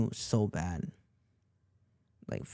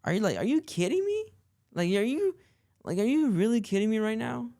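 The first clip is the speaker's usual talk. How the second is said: much higher-pitched disbelieving question; incredulous; 11 semitones higher, 3 dB louder than usual. Retold or imitated. Boyfriend answering a gaslighting girlfriend, incredulous. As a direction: act out stunned, exasperated disbelief.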